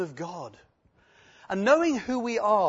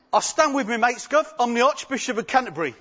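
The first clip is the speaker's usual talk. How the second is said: put-on higher, louder cheeky Cockney boy, fast; Cockney lad bluffing a policeman, with a laugh; Cockney accent, quick and cheeky; 7 semitones higher, 8 dB louder than usual. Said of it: "this little Cockney lad".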